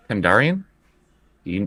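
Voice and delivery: normal talking voice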